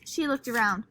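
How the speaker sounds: plain reading voice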